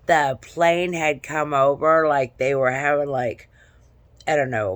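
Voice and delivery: ordinary talk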